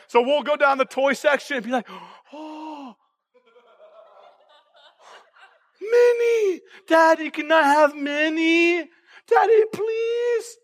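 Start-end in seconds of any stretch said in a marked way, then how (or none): 2.3-10.6 very high, slow, crying plea; whiny toddler begging. Retold or imitated. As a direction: stretch it out like a child about to cry.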